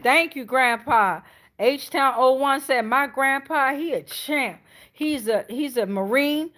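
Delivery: normal talking voice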